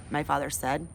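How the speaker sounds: ordinary delivery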